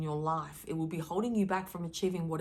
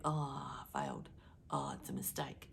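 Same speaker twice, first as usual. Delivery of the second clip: deflated whisper, much lower and softer; mock self-pity; 5 semitones lower, 11 dB quieter than usual. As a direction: sink into mock defeat. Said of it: "I don't see it".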